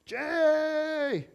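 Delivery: high drawn-out yell; retold yell. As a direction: imitate a distressed call across the water. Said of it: "and she yelled".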